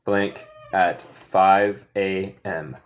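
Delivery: slow, deliberate prompt with a gap; slow fill-in-the-blank. Shown as a demonstration. Teacher prompting students to fill the blank, slow. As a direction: leave the blank hanging, slowly. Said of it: "My son".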